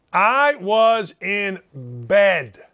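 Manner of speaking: very slow, each word set apart, I first; slow emphatic repeat. Shown as a demonstration. To his listeners, insisting he stayed home: slow emphatic repeat. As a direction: lean on it slowly, as if to settle any doubt.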